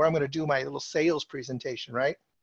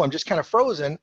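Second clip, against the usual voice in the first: usual speaking voice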